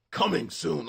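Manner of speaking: movie trailer narrator. Shown as a demonstration. Streamer repeating his AI ad line, movie narrator voice. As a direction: announce it big like a trailer voiceover. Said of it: "the narrator dude from the movie".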